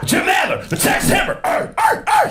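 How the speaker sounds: high, shouted dog-like growls; Jim Adler barking. Retold or imitated. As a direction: bark like a dog. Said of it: "Who let a dog in the courtroom".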